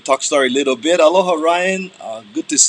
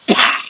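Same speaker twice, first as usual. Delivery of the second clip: sharp mouth slap pop; slap sound; 3 dB louder than usual. Shown as a demonstration. Showing how to slap Kurt, sharp pop. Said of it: "Like that".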